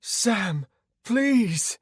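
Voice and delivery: high, desperate woman's cry; high-pitched anguished plea. Acted. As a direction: beg him, crying out. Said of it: "Anna cried".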